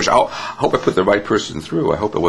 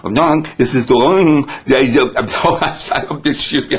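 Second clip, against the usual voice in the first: higher, muffled pretend mouthful; mouth full; 4 semitones higher, slower than usual. Receptionist answering the phone with pudding, mouth comically full. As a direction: answer the phone garbling words round imaginary food. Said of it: "I had food in my mouth".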